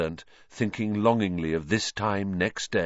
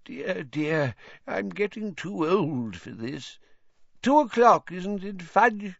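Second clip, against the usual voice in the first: put-on higher, slow feeble old voice; a very old wizard complaining feebly; 10 semitones higher, slower than usual. A narrator's reading. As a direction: quaver it like an ancient wizard. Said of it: "said in a feeble voice".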